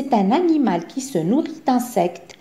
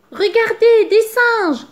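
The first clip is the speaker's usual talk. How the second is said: much higher, louder, slow thrilled call; excited exclaim; 12 semitones higher, 6 dB louder, slower than usual. A narrator's reading. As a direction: point it out with wonder. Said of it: "s'exclave Zigomar".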